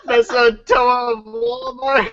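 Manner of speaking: high, laughing disbelief; laughing hard. Real, not acted. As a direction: crack up while talking. Laughing at fan art.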